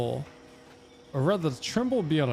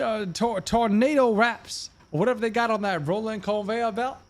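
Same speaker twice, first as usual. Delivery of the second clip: exaggerated Southern twang, higher pitched; Southern drawl impression; 7 semitones higher than usual. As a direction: ham up a thick Southern construction worker. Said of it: "the guys from Atlanta".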